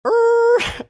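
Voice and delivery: very high, loud held squeal; imitated brake screech. Storyteller mimics brakes locking up, loud high screech.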